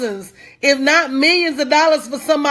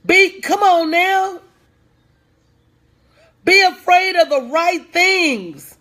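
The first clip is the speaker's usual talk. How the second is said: rising preaching; 3 semitones higher than usual. Real, not acted.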